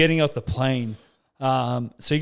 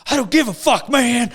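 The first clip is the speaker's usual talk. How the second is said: high-pitched, irate imitation; mock angry dismissal; 9 semitones higher, 4 dB louder than usual. Huge traveller dismissing an excuse in the line, mock angry. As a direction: act out a heated brush-off.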